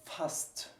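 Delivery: Fast with s and t apart; Fast, s and t each heard; separate s-t. Shown as a demonstration. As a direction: whisper Fast with a crisp s then t. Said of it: "at the end you can hear".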